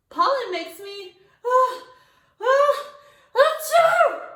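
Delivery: rising ah, ah then a loud high achoo; building sneeze. A narrator's reading. Child sneezing from pollen, rising gasps into loud sneeze.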